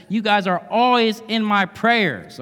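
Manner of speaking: quoting Paul with zealous emphasis; emphatic. Retold or imitated. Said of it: "He is emphatic".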